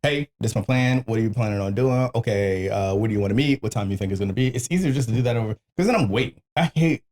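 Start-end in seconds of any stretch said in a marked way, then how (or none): none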